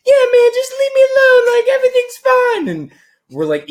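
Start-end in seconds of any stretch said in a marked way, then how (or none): 0.0-2.7 full high-pitched mock female falsetto, loud; Ian's drunk voice. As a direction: imitate a drunk squealing like a woman. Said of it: "just full high-pitched female".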